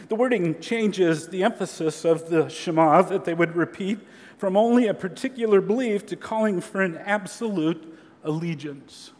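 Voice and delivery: ordinary talk, nothing marked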